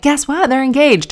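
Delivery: his parents breaking the engagement news, excited; imitating excited parents. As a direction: squeal the news like thrilled in-laws. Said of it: "And they were like".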